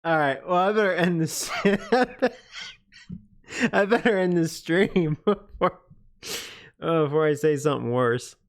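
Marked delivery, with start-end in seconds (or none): 0.5-5.8 talking through real laughter, higher pitched; cracking up. Real, not acted. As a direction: try to sign off while breaking into laughter. Cracking up at own crude joke.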